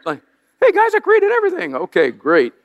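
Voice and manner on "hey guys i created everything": much higher, chummy and casual God; mock-casual God greeting, high and light. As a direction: play God as a breezy buddy, pitched way up. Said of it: "I really want him to have a deep bass voice".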